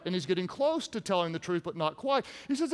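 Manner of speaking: ordinary talk, nothing marked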